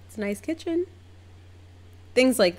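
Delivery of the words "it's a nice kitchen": high-pitched, tentative compliment, rising; squeaky-polite noncommittal remark; polite underwhelm. Retold or imitated. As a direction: offer faint praise in a high voice. Underwhelmed polite reply to boasting.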